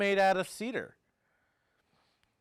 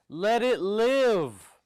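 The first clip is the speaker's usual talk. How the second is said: slow, higher and louder call; yelled out; 5 semitones higher, 8 dB louder, slower than usual. Retold or imitated. Carver calling life into the carved seal, slow yell. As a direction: command it to live in a slow ringing yell. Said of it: "He yelled out".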